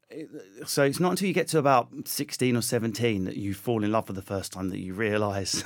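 ordinary delivery

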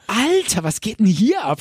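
high, stunned exclamation; man waking up inside the game, amazed. Retold or imitated. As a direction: gasp at the new world.

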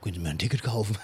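lightly voiced father, laughing a little; father's voice. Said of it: "Und mein Vater ist dann so einer".

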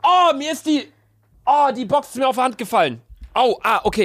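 high startled ouch; sudden pain. Real, not acted. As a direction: yelp as something hits the hand. Box fell on his hand.

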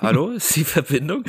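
mock phone-check shout; pretend calling out. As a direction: call into a supposedly dead line. Pretend call on bad connection.

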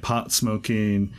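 ordinary talk, nothing marked

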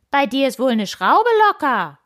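high-pitched, combative jeer; aggressive cry. A narrator's reading. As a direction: yell it spoiling for a fight. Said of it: "schrie Koks angriffslustig". (angriffslustig geschrien)

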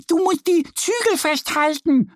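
very high duck voice, gently warning; a little duck keeping him safe; admonishing duck. A narrator's reading. Annie warning Mo to hold the reins, high duck voice. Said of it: "ermahnt ihn Annie".

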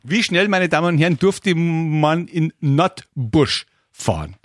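drawn-out game show host address; milk the question for the audience; slow showmaster question. Acted.

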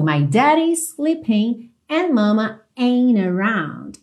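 "ain't around" without the T, "daddy's" tapped; linked American lyric. Shown as a demonstration. Teacher modelling the line slowly, "ain't around" linked. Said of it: "esse T pode ser omitido".

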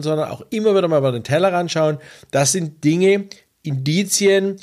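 normal talking voice

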